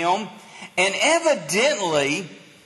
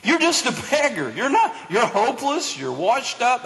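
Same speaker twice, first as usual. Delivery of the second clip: higher-pitched, quick scolding; mock yelled rebuke; 4 semitones higher than usual. Crowd yells at a blind beggar, higher mock scolding. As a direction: rattle off a mock put-down, pitched up. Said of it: "The people in front yelled at him".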